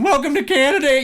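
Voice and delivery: put-on high, loud caricature of a Canadian accent. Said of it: "everybody's going".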